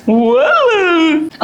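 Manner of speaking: posh anglicised "well", drawn out; mock English accent. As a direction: imitate her put-on English accent. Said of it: "अंग्रेजी accent तो ऐसे ले रही है".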